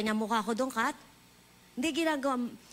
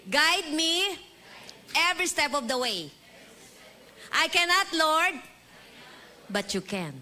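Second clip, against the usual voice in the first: call-and-response prayer; 4 semitones higher, slower than usual. Shown as a demonstration. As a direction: lead the church in prayer, phrase by phrase. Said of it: "Everybody after me".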